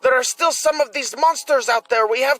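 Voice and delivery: put-on an eager young fighter refusing to retreat; young man pleading. Young man argues to continue.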